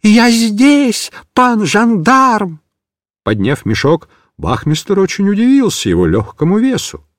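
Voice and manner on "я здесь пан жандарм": joyful cry. A narrator's reading. Kitten answering the gendarme, joyful and high. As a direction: cheer at being found. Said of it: "Микиш радостно воскликнул".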